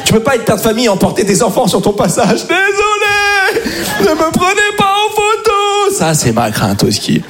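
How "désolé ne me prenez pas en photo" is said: high, long-drawn wail of apology; cri désespéré. Acted. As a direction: call it out helplessly while being dragged away. Crie en emportant des enfants. (cri aigu et traînant, affolé)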